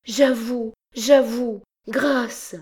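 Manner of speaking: high, desperate cries for mercy; broken pleading. A narrator's reading. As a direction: gasp out the surrender. Girl breaks under torture. (aveux désespérés, aigus et brisés)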